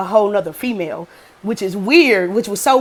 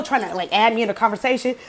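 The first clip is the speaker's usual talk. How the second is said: ordinary talk, nothing marked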